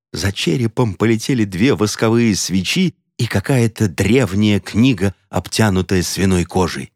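ordinary delivery

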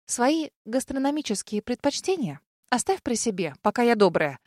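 usual speaking voice

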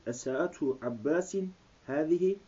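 normal talking voice

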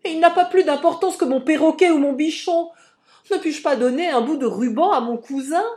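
high, loud indignant outburst; high-pitched exasperated cry; indignant protest. A narrator's reading. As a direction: protest loudly, dismissing the accusation. Girl protests in the quarrel.

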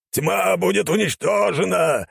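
high, slow battle cry; holy zealot's drawn-out vow; fanatical cry. A narrator's reading. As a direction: proclaim it high, drawing out the words. Old warrior recites his battle cry.

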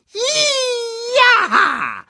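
sehr hoher, laut gerufener Jubel; hohe Stimme. A narrator's reading. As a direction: ein schriller Freudenschrei des Erdmännchens. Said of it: "rief eine hohe Stimme". (hoher Erdmännchenruf)

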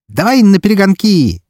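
wild laughing. A narrator's reading. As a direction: fling it out with crazy glee. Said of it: "безумно расхохоталась лисичка".

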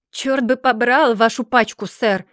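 loud, furious outburst; uncontrollable fury. A narrator's reading. Mr. Dorrit rejects John's cigars, shouting in fury. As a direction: curse at him in a rage. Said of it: "крикнул мистер Дорит с неудержимым бешенством".

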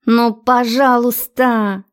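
slow, drawn-out wheedle; wheedling. A narrator's reading. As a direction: stretch the please out coaxingly. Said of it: "клянчила она".

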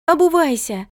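high-pitched shouted command; insistent high yell; shouted order. A narrator's reading. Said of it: "закричала она".

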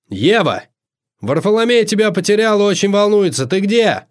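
magically amplified call. A narrator's reading. Host calling for missing Eva through the house, amplified call. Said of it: "Голос Амадея был многократно усилен колдовством".

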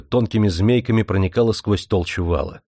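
plain reading voice

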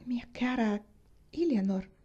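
whispered, holding back tears; stifled whisper. A narrator's reading. Catherine whispers to Eleanor, stifling her feelings. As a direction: breathe the words, choking feeling down. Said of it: "soffocando come poteva i propri sentimenti".

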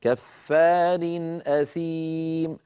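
slow "كفار أثيم", long vowels; clear izhar recitation. Shown as a demonstration. Teacher shows tanween made clear at hamza, izhar recitation. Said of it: "إن هذا التنوين مظهر عند الهمزة".

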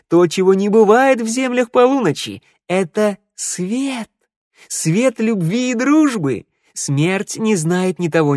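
high, slow, fervent minstrel; wise minstrel. Acted. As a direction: preach the power of love. Minstrel's sermon on love.

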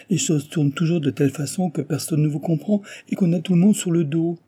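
high-pitched, agitated lament; woeful protest. A narrator's reading. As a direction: plead a wretched case to the marquis. Valet laments his binding promise. (se lamenter avec agitation)

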